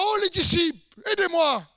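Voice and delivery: high, loud imitated plea; mock crying out. Preacher voicing what the woman never cried to the disciples, crying out. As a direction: call out for help, acted. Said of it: "Elle criait pas".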